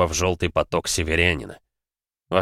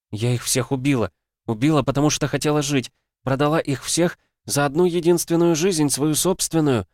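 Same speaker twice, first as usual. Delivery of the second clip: much higher, rising anguished voice; anguished confession; 8 semitones higher than usual. Acted. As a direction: confess it in despair. Heroine confesses, breaking.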